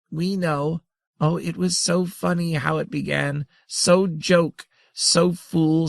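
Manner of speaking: high, giggly broken-English creature voice; lightly voiced tiny grandmother. A narrator's reading. The grandmother gloats over how funny the beginning was, high and giggly. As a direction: savour each silly word.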